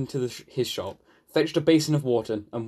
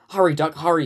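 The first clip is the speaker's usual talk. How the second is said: mildly urgent, slightly raised call; 3 semitones higher, 4 dB louder than usual. Acted. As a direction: call out to hurry him along. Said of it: "he called urgently".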